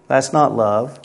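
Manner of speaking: ordinary delivery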